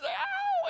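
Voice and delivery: high, loud yelp of pain; imitated child's shriek. Boy shrieks as needle hits, imitated pain cry. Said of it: "he shrieked".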